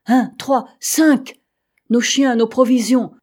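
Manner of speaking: high, loud, mounting count; alarmed exclaiming. A narrator's reading. Men see bears raiding their supplies, exclaiming in alarm.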